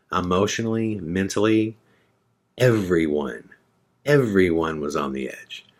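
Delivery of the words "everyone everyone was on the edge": ordinary delivery, nothing marked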